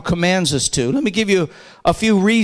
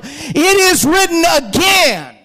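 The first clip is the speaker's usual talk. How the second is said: higher, drawn-out scripture quote; forceful preaching; 7 semitones higher, slower than usual. Acted. Preacher quotes Jesus answering Satan, forceful and drawn out. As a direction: build to a ringing, stretched final word.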